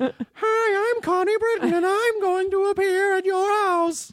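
very high, slow put-on voice; hammed-up squeaky send-up of an actress; Connie Britton impression. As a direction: falsetto mock introduction. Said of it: "you clearly faked that voice".

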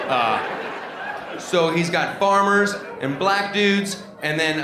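usual speaking voice

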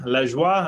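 Lajoie said "lezh-WAH"; French pronunciation. Shown as a demonstration. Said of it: "I've heard that pronunciation".

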